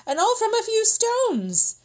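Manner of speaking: high, astonished exclaim; amazed. A narrator's reading. Narrator voicing peasants' wonder at stone soup, amazed. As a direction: marvel at the magic.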